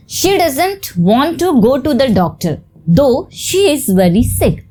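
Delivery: very slow, every word distinct; slow example reading. Shown as a demonstration. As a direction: read it to the class like dictation. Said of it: "teacher reads example sentence". (बहुत धीरे, dictation की तरह)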